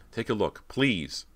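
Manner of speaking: high, urgent plea; pleading. A narrator's reading. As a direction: beg her to look. Girl pleads with her sister.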